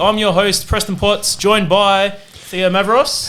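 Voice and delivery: upbeat podcast opener; podcast host intro. Acted. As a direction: welcome the listeners like a show host.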